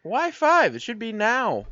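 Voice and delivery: squeaky, outraged protest; why not now, voice jumping high; high indignant whine. Real, not acted. Excited complaint, pitch way up.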